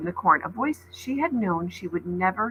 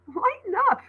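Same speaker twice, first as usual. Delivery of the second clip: laughing; 9 semitones higher, 3 dB louder than usual. A narrator's reading. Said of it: "Seth stepped back, laughing".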